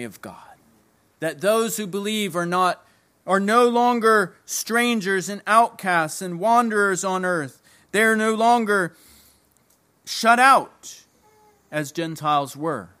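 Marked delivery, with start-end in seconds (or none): 3.7-11.0 builds higher and louder into shut out; rising preaching emphasis. Real, not acted. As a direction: climb to a ringing shut out.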